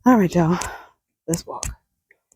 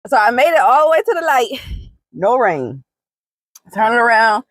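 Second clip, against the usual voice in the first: excited; 7 semitones higher, 5 dB louder than usual. Real, not acted. Excited after walk in rain.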